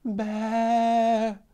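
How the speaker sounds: high bleat; goat bleating. A narrator's reading. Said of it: "még egyszer hallatta a hangját".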